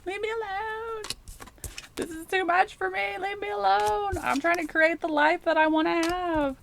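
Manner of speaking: high, pleading, fed-up voice; a put-upon Scorpio begging to be left alone; mock whiny plea. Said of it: "You're like".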